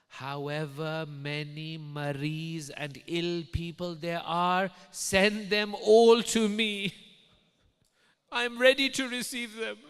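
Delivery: slow build to a high, booming call; proclaiming Imam. Retold or imitated. Imam summons all the sick to him, proclaiming. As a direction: swell to full preaching force.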